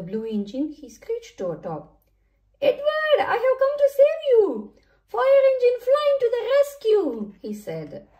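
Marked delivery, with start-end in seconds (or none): 2.6-7.3 very high, loud rescue cry; heroic shout. A narrator's reading. Fire engine reaches Edward to save him, shouting heroically. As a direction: call out like a storybook hero arriving.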